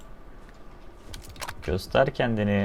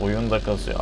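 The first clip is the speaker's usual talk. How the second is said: usual speaking voice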